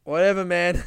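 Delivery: reluctant comic agreeing to the gag; grudging whatever. Retold or imitated. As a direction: give in with a grumble. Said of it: "I'm just like".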